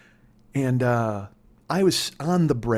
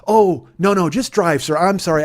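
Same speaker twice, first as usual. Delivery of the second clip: loud, higher mock pleading; alarmed backpedalling; 5 semitones higher, 7 dB louder than usual. Passenger begs the furious driver to go on, alarmed backpedalling. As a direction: imitate a passenger hastily taking it back.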